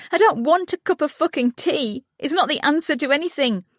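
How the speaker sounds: high furious outburst; a grieving mother lashing out at her husband, high and furious; angry outburst. A narrator's reading. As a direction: explode at him, pitch soaring.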